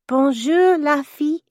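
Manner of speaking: put-on small boy's high, rehearsed French greeting; Philip. Little boy greets French guest with practiced words, high childlike voice. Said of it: "in his version of the words Alexander had made him practice".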